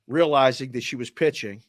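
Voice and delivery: normal talking voice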